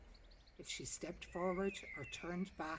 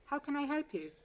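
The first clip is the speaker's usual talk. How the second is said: bright, higher phone-greeting receptionist; 9 semitones higher, 8 dB louder than usual. A narrator's reading. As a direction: answer the phone like a polite office receptionist.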